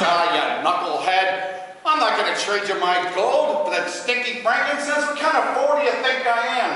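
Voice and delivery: gruff, loud Mo scolding; Mo impression. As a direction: snap at Curly as Mo. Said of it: "He says".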